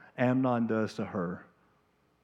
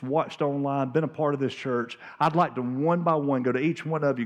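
ordinary talk, nothing marked

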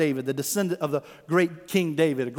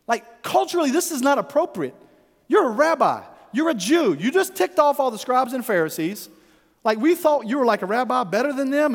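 high-pitched, flustered objection; mock indignant appeal, pitch pushed up; embarrassed protest; 7 semitones higher, 3 dB louder than usual. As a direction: act out scandalized disciples scolding their teacher. Disciples voiced, embarrassed, protesting to Jesus.

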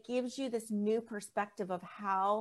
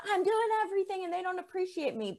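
higher, fast mock-frustrated complaint; frustrated parent; 9 semitones higher, faster than usual. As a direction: vent like a fed-up parent. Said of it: "angry and frustrated".

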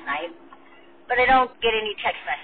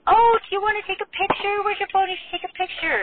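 much higher, bubbly imitation of the nurses; mock the eager nurses pushing the phone; chirpy nurse; 14 semitones higher, 4 dB louder than usual. Nurses urging to take photo.

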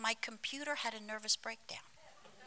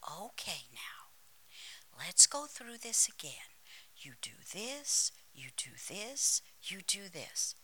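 associate pastor; 2 semitones lower, 5 dB quieter than usual. Retold or imitated. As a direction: softly mimic someone patiently explaining the steps. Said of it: "So he proceeded to say".

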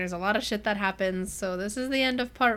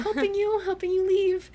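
high, playful imitation of a helper; a hint of high-voiced accomplice; lightly voiced helper; 10 semitones higher, 3 dB louder than usual. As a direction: lift it high, lightly mimicking. Voicing Cress helping secretly.

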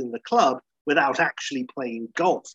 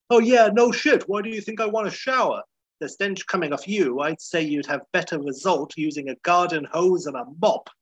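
higher, louder whiny retort; Larry David impression; 5 semitones higher, 3 dB louder than usual. Larry mocking the manager's smell, in a high whiny voice. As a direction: snap back like a cranky nebbish. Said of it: "Larry answering the manager".

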